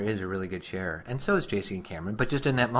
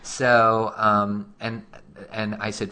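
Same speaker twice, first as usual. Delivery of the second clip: ordinary delivery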